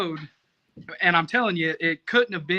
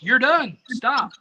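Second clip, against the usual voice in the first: imitating the organizer's loud, higher yell; retold yell; 6 semitones higher, 6 dB louder, slower than usual. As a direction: call it out like the rep halting a runner. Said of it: "when he was yelled at me".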